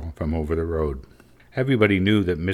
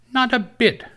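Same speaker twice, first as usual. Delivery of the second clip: put-on very high, louder child voice; twins in chorus; 5 dB louder than usual. A narrator's reading. Twins backing up their sister, high emphatic chorus. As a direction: pipe up as two children together. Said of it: "said the twins both together".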